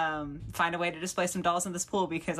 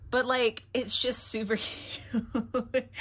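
laughing; 6 semitones higher, slower than usual. Real, not acted. Gushing over toy playset.

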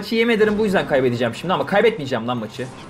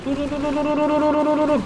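much higher, rapid strained repeats of dur; panicked pleading; 11 semitones higher than usual. Real, not acted. Gamer panicking as the bomber advances, frantic. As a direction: beg it to stop in panic.

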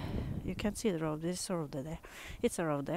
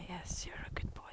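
soft whisper of praise; 10 dB quieter, faster than usual. Real, not acted. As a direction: breathe the praise to him.

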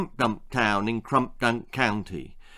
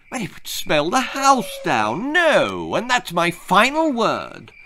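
fussy mother; 7 semitones higher, 4 dB louder than usual. Acted. Mother puts foot down.

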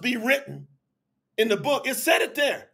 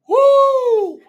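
high, loud "woo"; long high-pitched holler of praise; exultant whoop; 13 semitones higher, 9 dB louder than usual. Real, not acted. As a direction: let out a big joyful whoop. Exultant whoop during sermon.

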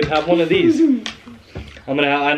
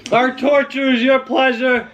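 catchphrase sign-off; 8 semitones higher than usual. Acted. Channel outro catchphrase.

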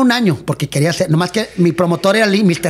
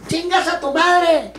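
mock yell; 10 semitones higher, much slower than usual. Rival yells insult across arena.

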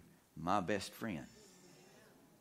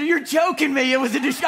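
high, loud, incredulous wife voice; a wife who can't believe what she just heard; wife's astonished reply; 14 dB louder than usual. Retold or imitated. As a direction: mimic her disbelief, pitched way up. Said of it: "She said".

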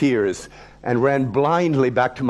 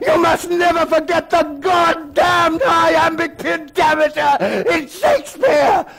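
much higher, louder enraged headmaster shouting; furious scream; 13 semitones higher, 5 dB louder than usual. Acted. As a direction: scream at the pupil like a mad dog. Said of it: "he began to scream".

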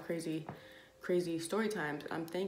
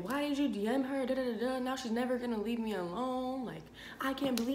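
mock accusing boyfriend; 6 semitones higher than usual. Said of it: "he tried to flip it on me".

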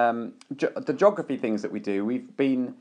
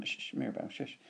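hushing whisper; 14 dB quieter, faster than usual. Real, not acted. Parent hushing a giggling child during the podcast, whispered. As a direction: whisper the shush so it doesn't carry.

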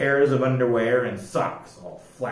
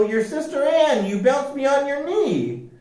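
much higher, earnest pleading; high pleading Joe; 11 semitones higher than usual. A narrator's reading. Joe reminding his sister of childhood, high pleading. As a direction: remind her fondly.

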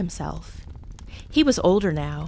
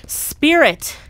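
pleading; 4 semitones higher, 7 dB louder, slower than usual. A narrator's reading. Scrooge begs the ghost.

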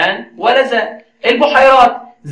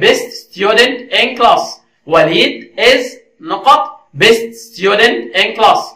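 very slow, word-by-word quiz reading; slow dictation; 3 semitones higher, much slower than usual. Shown as a demonstration. Teacher reading a gap-fill question to learners, slow dictation. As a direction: read the question out slowly, pausing at the gap.

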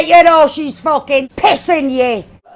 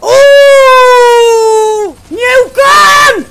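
high, loud whooping; excited shouting; 5 semitones higher, 5 dB louder, much slower than usual. Real, not acted. Player reacting to a new gun, excited shouting.

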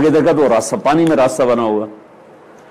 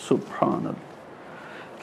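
whispered, hushed in wonder; awed whisper; 15 dB quieter, much slower than usual. Real, not acted. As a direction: breathe it out in awe. Awed aside in sermon.

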